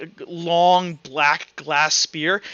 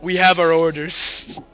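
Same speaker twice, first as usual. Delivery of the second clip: firm, louder soldier's reply; knight in character; 7 dB louder than usual. Acted. Game master's knight answers the player's taunt, firm and loud. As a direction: state it like a soldier under orders.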